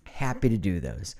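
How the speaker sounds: ordinary delivery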